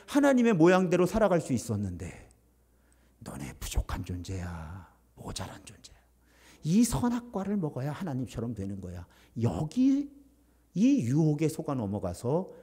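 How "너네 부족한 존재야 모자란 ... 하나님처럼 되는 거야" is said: low, hushed tempting voice, fading to a whisper; sly tempter. Acted. Satan whispering to the first humans, low and hushed. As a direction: lure them in quietly like the serpent.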